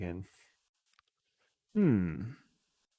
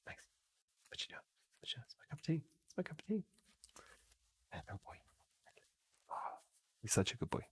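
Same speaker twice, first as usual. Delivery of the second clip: soft, high, cooing to a pet; 5 semitones higher, 15 dB quieter, faster than usual. Real, not acted. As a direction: whisper sweet nothings to a dog. Cooing at the dog.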